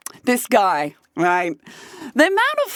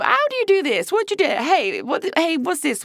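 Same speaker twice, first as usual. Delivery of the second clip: pitched-up, eager mock questions; a higher mock-excited Ian; imitating boyfriend; 6 semitones higher, faster than usual. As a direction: poke at the app like a curious kid. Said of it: "and ask, you know, as you do".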